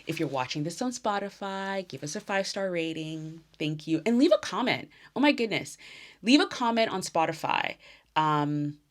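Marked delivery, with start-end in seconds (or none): 4.1-7.8 excited plea. Real, not acted. Excited push for comments.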